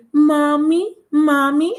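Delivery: mock babyish whine, rising at the end; grown man babyish. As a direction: mimic a man calling like a needy child. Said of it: "It was a grown-ass man".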